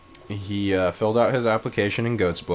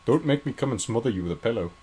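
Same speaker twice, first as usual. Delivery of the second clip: quick, lightly put-on British accent; mock threat in a light British accent; [name]; 3 semitones higher, faster than usual. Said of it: "Can you do a British accent?".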